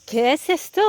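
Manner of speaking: playful French-accented attempt at Spanish; mock-proud Spanish accent. Hiker rehearsing asking a local man, joking Spanish accent. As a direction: try out your "super" Spanish accent, rising into a question. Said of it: "Avec mon super accent".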